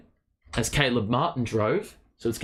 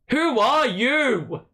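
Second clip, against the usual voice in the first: higher taunting who are you; 9 semitones higher, 4 dB louder, much slower than usual. Retold or imitated. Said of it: "And he's like".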